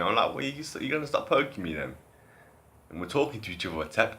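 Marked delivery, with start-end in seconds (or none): 0.3-2.0 retold complaint.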